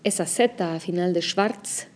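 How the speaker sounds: schwarz ending in a sharp ts; strong final z. Shown as a demonstration. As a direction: say schwarz with a hard ts at the end. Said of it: "tiene que sonar fuerte".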